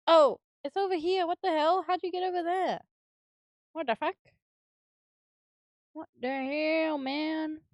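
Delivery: high surprised exclaim. Real, not acted. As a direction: sound startled at the find. Finds missing piece.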